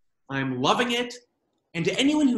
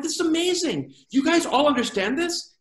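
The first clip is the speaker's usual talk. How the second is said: excited realization; 7 semitones higher than usual. Retold or imitated. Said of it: "I'm like".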